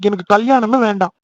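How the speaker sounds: loud tearful wail, voice jumping up; crying protest. Acted. Brother wailing to his sister that he wants no marriage at all. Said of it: "அழுதுகிட்டு இருக்க".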